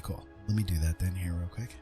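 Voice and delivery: normal talking voice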